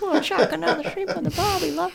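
high, laughing mock Aussie accent; Australian accent. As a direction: tease with a giggly Australian twang. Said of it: "and then you're gonna be like".

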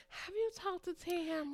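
lightly voiced mama, high and slow; imitated nagging mama. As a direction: mimic a mother's repeated question. Voices her mama's repeated question.

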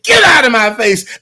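loud shouted brush-off; angry retort. Retold or imitated. Retelling Shaft scene, woman shouting at ex. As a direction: throw him out with a yell. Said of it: "she got so mad and said".

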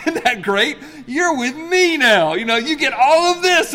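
hammed-up happy new husband; gleeful retold reply. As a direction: gush with delight that she's leaving home. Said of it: "It's like what I'm thinking".

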